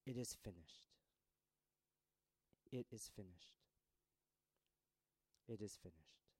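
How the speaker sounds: Jesus on the cross, fading into silence; fading last words. Acted. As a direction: let it die away. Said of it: "he cries out".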